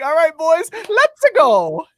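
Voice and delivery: high, loud cartoon rally cry; Mario pumping up huddle. Quarterback pumping up the huddle, high loud Mario voice. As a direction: rise into a loud, high cheer. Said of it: "In the huddle, pumping him up. He's like".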